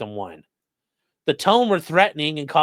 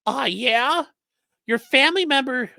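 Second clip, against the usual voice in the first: much higher mocking put-on voice; castrato voice; 8 semitones higher than usual. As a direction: read the caller in a falsetto. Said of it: "doing the fucking castrati thing".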